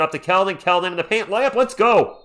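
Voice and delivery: loud, high shout of celebration; real excited yell, pitched high; excited play-by-play. Real, not acted. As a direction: erupt as the layup drops. Calling an exciting layup live.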